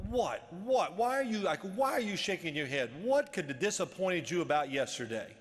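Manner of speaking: retold indignant questioning, punched words; exasperated disbelief. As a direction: demand answers, voice rising in disbelief. Retold disbelief at coach's head shake.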